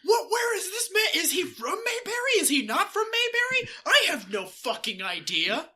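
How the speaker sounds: high shouted confusion with a laugh; bewildered yelling. Retold or imitated. Mayberry locals baffled by a stranger, high laughing shouts. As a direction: yelp in total confusion. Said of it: "they would have been like".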